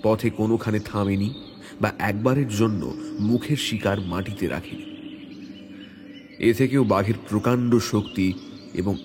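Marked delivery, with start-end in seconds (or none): none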